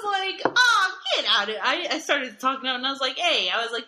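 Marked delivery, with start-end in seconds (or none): 0.5-1.6 higher and louder exasperated call. Retold or imitated. Telling the rude patient off, loud call. 3.2-3.5 higher, louder hey to get attention; sharp call. Retold or imitated. Calling to the rude patient, sharp hey.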